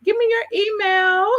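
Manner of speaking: high, lightly voiced quote of a sweet friend; mock her quick sweet request; imitating April. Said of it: "she just said".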